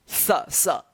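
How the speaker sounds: "स" said as a sharp s with the tongue between the teeth; dental s sound. Shown as a demonstration. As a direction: hiss "स" through the teeth. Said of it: "दोनों दांत के बीच में है ना मेरा जीभ आ रहा है स". (दांतों के बीच से "स")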